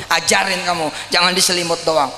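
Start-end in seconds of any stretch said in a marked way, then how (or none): none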